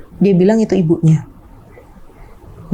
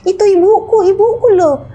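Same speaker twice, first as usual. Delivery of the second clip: put-on much higher, slower inviting old man; imitating father; 13 semitones higher than usual. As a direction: call high and slow like a confused father inviting a ghost. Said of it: "katanya gitu".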